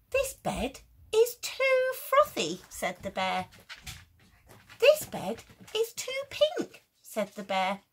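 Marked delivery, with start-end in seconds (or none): 0.1-2.6 high, slow and loud, fussy bear; squeaky fussy complaint. Acted. Fussy bear rejecting bed. 4.8-6.8 high loud squeal of disgust; squeaky fussy complaint. Acted. Fussy bear rejecting bed.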